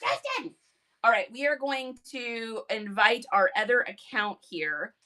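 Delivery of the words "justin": high loud shout of the name. Shown as a demonstration. Showing fans her famous [name] scream, high yell. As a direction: scream his name like she always does. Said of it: "have me screaming [name]".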